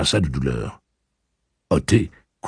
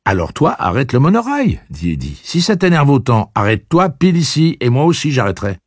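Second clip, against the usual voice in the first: defiant; 10 semitones higher, 6 dB louder than usual. Acted. Eddie challenging Blaine.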